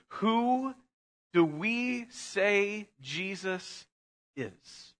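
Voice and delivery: very slow, Who lifted high, fading away on is; hushed, drawn-out question to the congregation; slow solemn question. Acted. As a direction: draw it out and let it fade. Climactic sermon question.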